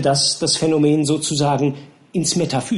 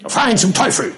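angry captain; 6 semitones higher than usual. Acted. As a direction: order him away at once. Captain curses. (wütend befehlend)

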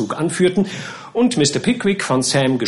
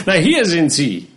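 raised, emphatic retort; matter-of-fact retort; 6 semitones higher, 4 dB louder than usual. A narrator's reading. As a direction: point out the obvious with emphasis. Wardle retorts, showing the birds.

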